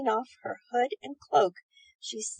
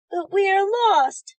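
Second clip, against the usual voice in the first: mock sob; 9 semitones higher, 8 dB louder, slower than usual. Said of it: "sobbed one of the boys".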